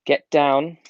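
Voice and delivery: ordinary talk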